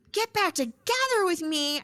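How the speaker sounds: high-pitched mock outrage; irate. Wife recoiling at her ex's offer, irate. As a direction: throw the offer back, indignant and high. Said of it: "just irate".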